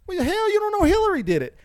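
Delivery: retold shout. Snapping back at a coworker in a retold argument, loud. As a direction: fire it back, exasperated. Arguing with Trump supporter coworker.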